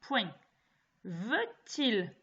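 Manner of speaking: slow, spaced dictation voice; dictating. Shown as a demonstration. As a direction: read it out word by word for pupils. Teacher dictates to pupils.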